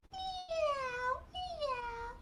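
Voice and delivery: high, soft kitty mew; cat meow. Acted. Storyteller shows the cat sound, high soft meow.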